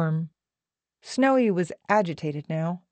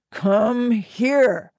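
stretched-out, higher-pitched summons; slow, insistent call; coaxing order; 5 semitones higher, 4 dB louder than usual. A narrator's reading. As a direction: draw both words out insistently. Otto summons her more insistently.

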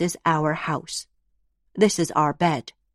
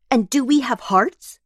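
put-on child voice; 8 semitones higher, 4 dB louder than usual. Child asks, voiced higher.

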